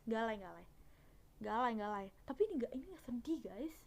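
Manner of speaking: ordinary talk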